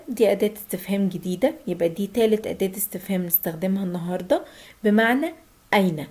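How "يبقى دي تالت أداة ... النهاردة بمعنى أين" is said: usual speaking voice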